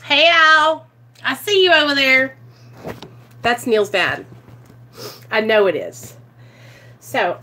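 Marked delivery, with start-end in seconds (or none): 0.0-2.4 louder, lifted greeting across a distance; calling out. Real, not acted. Greeting a cardinal as late father-in-law, calling out. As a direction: call over to someone you spotted. Calls out to cardinal as Neil's dad.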